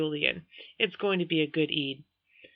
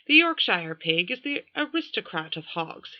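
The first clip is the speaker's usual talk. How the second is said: put-on lightly posh British royal accent; a fair Prince Charles British accent, pig lecture; Prince Charles impression. Narrator doing Julian as Prince Charles, British accent. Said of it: "in a fair imitation of Prince Charles".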